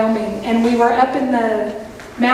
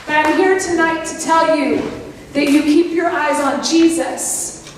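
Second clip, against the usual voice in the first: ordinary talk, nothing marked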